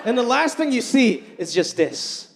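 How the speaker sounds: ordinary talk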